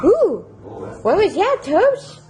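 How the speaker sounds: high startled ooh; surprised. Real, not acted. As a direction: react to a sudden squeal. Surprised by a squeal.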